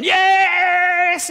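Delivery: high, loud shout of joy; celebrating shout. Retold or imitated. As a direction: shout it like a neighbour whose team just won. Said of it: "people running out of houses going".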